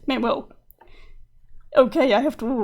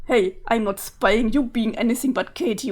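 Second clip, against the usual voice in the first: usual speaking voice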